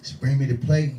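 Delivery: normal talking voice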